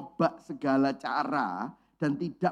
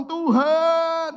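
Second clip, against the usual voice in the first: crying out to God; 10 semitones higher, 7 dB louder than usual. Retold or imitated. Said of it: "tiba-tiba baru ngomong".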